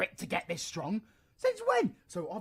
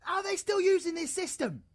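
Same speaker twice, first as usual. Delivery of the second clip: annoyed; 8 semitones higher than usual. Real, not acted. Annoyed at old game system.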